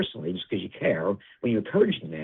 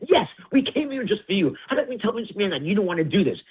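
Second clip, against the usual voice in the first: retorting to Rock; 11 semitones higher than usual. Retold or imitated. Retold argument with Rock.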